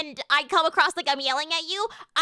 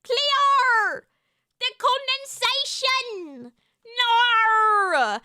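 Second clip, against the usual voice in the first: put-on Australian accent; 5 semitones higher, much slower than usual. Streamer quoting H2O to get the accent, mock Australian. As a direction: call it out like a hammed-up Australian. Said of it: "I quote the H two O".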